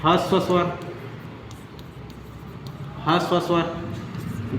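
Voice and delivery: dictating ह्रस्व स्वर word by word for notes; slow clear dictation. Shown as a demonstration. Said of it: "तो पहला याद रखना". (धीरे और साफ़ बोलकर लिखवाना)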